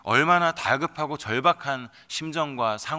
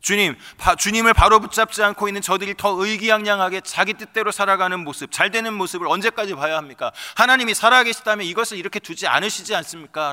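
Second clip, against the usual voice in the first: higher and louder, demanding answers; raised, desperate lament; anguished outcry; 6 semitones higher, 9 dB louder than usual. Acted. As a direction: hurl the questions at heaven. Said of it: "라고 하는 절규를".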